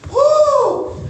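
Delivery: high puffing whoo of a tired runner; winded whoo. Retold or imitated. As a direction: blow out a high breathless whoo. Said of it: "I'm just dying".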